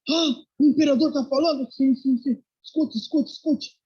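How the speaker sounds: high, loud gasp of disbelief; excited, urgent retold reaction; astonished. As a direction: act out stunned friends hushing each other at the radio. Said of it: "o amigo tá atônito".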